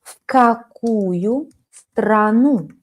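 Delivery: very slow В какую страну; slow model question. Shown as a demonstration. Teacher models the question very slowly. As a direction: draw out every word slowly. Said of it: "Silent move".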